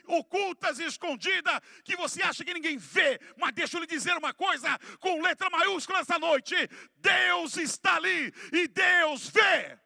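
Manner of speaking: high, loud preaching building to a shout; full-force preaching. Real, not acted. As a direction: hammer each phrase harder up to a roaring finish.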